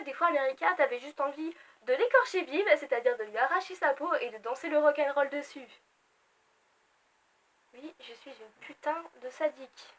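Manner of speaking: normal talking voice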